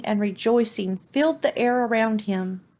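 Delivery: ordinary delivery, nothing marked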